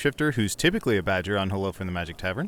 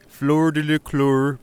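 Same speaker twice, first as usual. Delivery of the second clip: singsong Muppet chef gibberish voice; Swedish Chef; 4 semitones higher, 4 dB louder, much slower than usual. Shown as a demonstration. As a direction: garble the French name like a Muppet cook. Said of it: "I say it like the Swedish chef".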